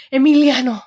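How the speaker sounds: Emiliano called loud and dramatic; telenovela acting. Streamer calling Emiliano in telenovela style. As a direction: cry out Emiliano like a soap star. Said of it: "my telenovela acting".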